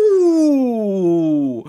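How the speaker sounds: delighted laugh. Real, not acted. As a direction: laugh out loud with glee at the find. Delighted by a scouting find.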